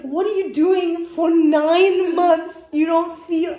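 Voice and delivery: high, slow, disbelieving rant; incredulous. Real, not acted. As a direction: stretch it out in disbelief. Incredulous rant about nine months.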